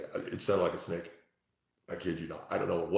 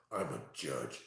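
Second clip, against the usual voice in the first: mocking impression; 8 semitones lower, 11 dB quieter, slower than usual. Mocking chatter who claims judge.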